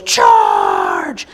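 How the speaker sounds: loud battle cry; yelled charge. Retold or imitated. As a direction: roar it as you run downhill. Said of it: "the guy on the left yells".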